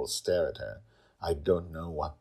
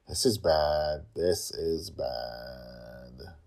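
dismayed; much slower than usual. Acted. Father overwhelmed by the fight, slow fading dismay. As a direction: say it slower each time.

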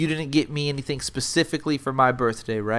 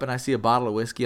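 usual speaking voice

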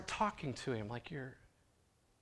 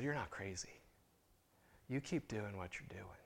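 reassuring; 4 semitones lower, 12 dB quieter than usual. Retold or imitated. Brennan reassuring the old man, soft and gentle. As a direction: lower and softer, gentle.

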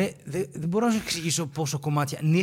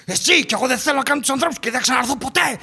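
higher, shouted walk-out line; retold shout; 6 semitones higher, 3 dB louder than usual. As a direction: yell it as you storm off. Neighbour storms off shouting. (ο γείτονας φεύγει φωνάζοντας)

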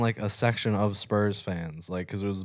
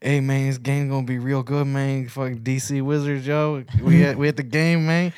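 imitating a Chicano homeboy, raised and loud; 4 semitones higher, 4 dB louder than usual.

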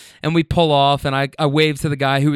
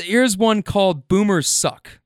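usual speaking voice

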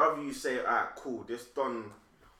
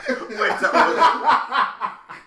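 slow and louder through laughter; laughing while talking; 8 semitones higher, 10 dB louder, much slower than usual. Real, not acted. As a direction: ask it while cracking up.

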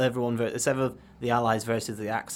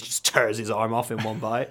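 normal talking voice

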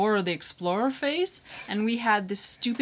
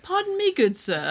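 high, lofty, mannered gentleman voice; bow and speak like a fussy Elizabethan; Shakespearean English; 9 semitones higher than usual. Retold or imitated. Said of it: "Shakespearean English".

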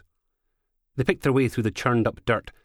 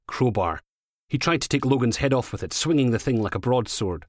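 ordinary delivery

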